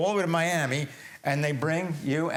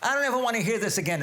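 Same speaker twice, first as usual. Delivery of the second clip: raised, fast and heated; indignant outburst; 7 semitones higher, faster than usual. Real, not acted. Host outraged at age limits.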